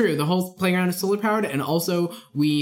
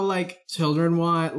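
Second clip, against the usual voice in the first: ordinary delivery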